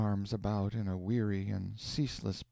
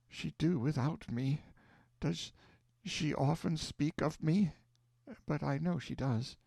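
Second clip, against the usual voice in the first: a gentle mother's voice, lightly higher; frail dying mother; 6 semitones higher than usual. Acted. Dying mother speaks tenderly.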